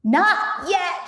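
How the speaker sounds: high, loud short shout; shouting Bogus. Acted. Farmer Bogus shouting back to Bean. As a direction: shout it over the engine. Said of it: "shouted Bogus".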